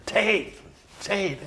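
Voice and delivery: high-pitched call across a crowded room; a painter calling out across a noisy pub. Retold or imitated. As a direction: shout his name to catch his eye from far away.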